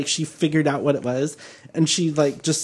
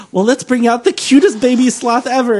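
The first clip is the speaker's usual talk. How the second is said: high, loud gushing talk-show host; mock excited announcement; 8 semitones higher, 5 dB louder than usual. Storyteller playing Ellen teasing her guest, mock excitement.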